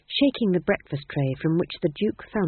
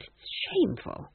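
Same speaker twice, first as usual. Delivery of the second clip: whispered; 13 semitones higher, 6 dB quieter than usual. Acted. Granny ashamed.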